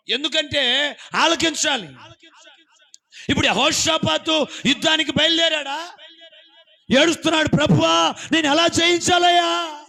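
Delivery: loud, high crying sermon; preaching at full force. Acted. Preacher voicing a weeping king, loud and high. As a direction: wail it out to the hall.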